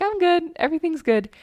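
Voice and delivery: high, loud, bright fake "I'm fine" chirp; fake cheerful. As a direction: brush it off with forced cheer. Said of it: "I'm just like".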